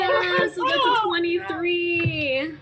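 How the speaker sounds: high, loud gleeful brag; real excitement. Real, not acted. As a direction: crow over the natural twenty. Celebrating natural twenty roll.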